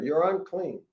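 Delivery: ordinary delivery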